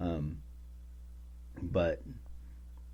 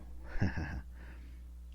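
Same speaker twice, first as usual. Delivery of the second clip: quiet unvoiced chuckle; a breathy laugh to himself; soft airy laugh; 3 semitones lower, 8 dB quieter than usual. Real, not acted. Laughs at own pun.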